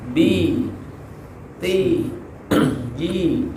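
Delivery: "bi, ti, ji" with the jaw dropped. Shown as a demonstration. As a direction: drop the jaw on each long "i" in "bi, ti, ji". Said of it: "turunkan rahang".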